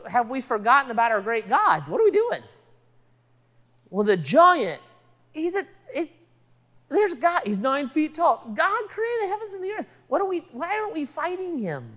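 high, quick, flustered questioning; agitated disbelief. Retold or imitated. David to the people around him about the giant, agitated disbelief. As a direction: sputter in high-pitched disbelief.

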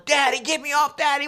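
put-on high pleading child voice; scared little girl. Daughter on the roller coaster begging dad, high pleading. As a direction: beg like a frightened kid. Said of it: "the whole time's yelling".

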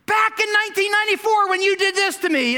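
high, loud whiny jab at your partner; mock accusing spouse. Spouse in a fight, mock high accusing voice. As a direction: play the spouse dredging up old hurts, shrill and petulant.

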